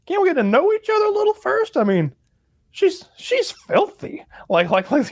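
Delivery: whiny high prissy protest; he's like. Retold or imitated. Mocking the hero's reluctance, high squeaky whine. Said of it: "he's like".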